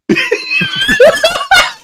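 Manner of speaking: a squeaky high laugh; high giggle. Real, not acted. Player laughing at the knight's defeat. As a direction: burst into a giggle.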